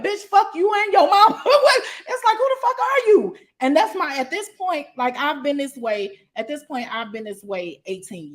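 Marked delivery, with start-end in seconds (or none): 0.0-3.4 laughing burst between the insults; laughing dismissal. Retold or imitated. Mocking the haters with a loud high laugh. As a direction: curse them out while laughing, pitch far higher.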